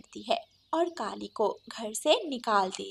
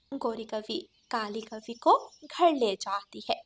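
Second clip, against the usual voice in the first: usual speaking voice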